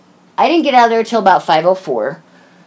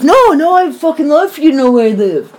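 high, loud mock drunk insistence; tipsy protest; 7 semitones higher, 4 dB louder than usual. Woman mimics her drunk date on the phone, tipsy protest. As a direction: imitate a drunk man over-insisting. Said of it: "you're too drunk to drive".

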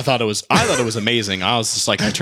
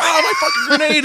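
put-on drunk guy's wail; 4 dB louder than usual. Guy wailing for his spilled drink, high and loud. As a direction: wail over the lost drink. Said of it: "he's like".

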